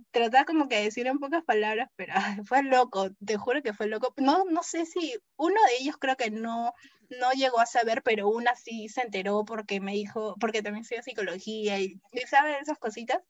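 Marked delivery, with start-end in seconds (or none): none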